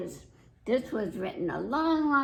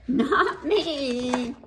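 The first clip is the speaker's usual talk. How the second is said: laughing pig; 4 semitones higher, 4 dB louder than usual. Acted. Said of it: "Boris said".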